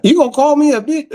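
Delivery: high-pitched mock indignation; quoted mama. His mama offended at the door, high mock indignation. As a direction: imitate an offended mother, high-voiced. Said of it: "That was his mama".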